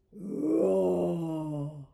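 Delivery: long, low playful roar; drawn-out pretend roar; mock lion roar. Imitating the lion before revealing it.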